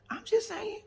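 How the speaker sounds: high, breathy whisper; a sly whispered aside. Real, not acted. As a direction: toss it off under the breath. Whispered aside about Portia.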